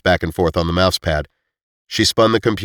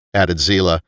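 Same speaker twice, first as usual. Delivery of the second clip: usual speaking voice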